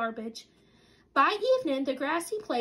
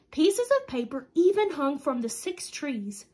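plain reading voice

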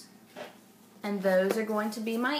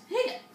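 high squeaky hiccup; 13 semitones higher, 3 dB louder than usual. Acted. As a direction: hiccup sound. Piglet hiccups in story.

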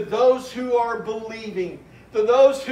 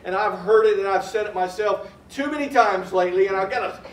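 ordinary talk

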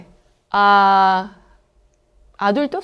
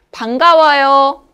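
normal talking voice

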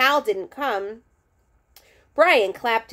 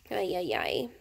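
soft, low ay ay ay; exasperated sigh; 4 semitones lower, 9 dB quieter than usual. Real, not acted. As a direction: groan it under your breath. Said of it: "reader's groan after chapter".

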